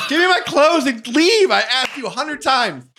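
high, loud, fed-up mock shriek; Elizabeth. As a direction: drag out the demand in a shrieking woman's voice. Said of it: "much better actor than Elizabeth".

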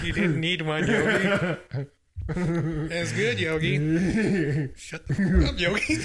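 cartoon bear voice. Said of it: "I love the Yogi Bear".

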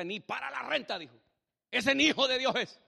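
mock outrage building to a loud verdict; indignant accusation. Mexican housemate judges the man without rent money, indignant accusation.

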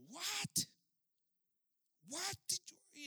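very high, incredulous outburst; mad disbelief. Acted. Chief reacting to words of love, mad disbelief. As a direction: shoot the pitch way up, acting outraged. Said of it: "he's all mad".

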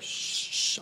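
shushing. Retold or imitated. Frat brothers hushing him.

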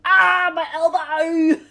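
normal talking voice

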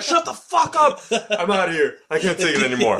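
high-pitched mock rant; exasperated outburst. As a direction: blow up at the same old joke, hammed-up. Acting out exasperation at repeated joke.

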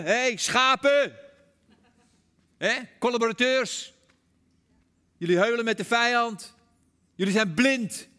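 loud, high accusing shouts, with pauses between; mock calling out. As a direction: hurl it like an accuser at the other camp. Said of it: "de wappies die roepen".

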